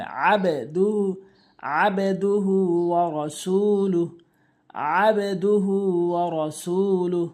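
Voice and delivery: আবেদুহু with a bouncing qalqala d; echoed d. Shown as a demonstration. Teacher showing correct recitation. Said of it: "এভাবে উচ্চারণ করব আমরা".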